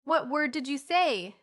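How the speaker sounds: drawn-out scolding question; stern demand. A narrator's reading. Mother scolds her child.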